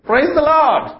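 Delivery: high, delighted cry of praise; joyful praise. Acted. Old lady finds the basket of food and cries out, high and overjoyed. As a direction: she bursts out with thanks.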